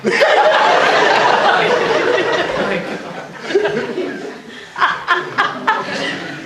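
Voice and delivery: high, breathy burst of laughter; long helpless laugh. Real, not acted. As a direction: let the laugh take over completely. Bursting out at the memory.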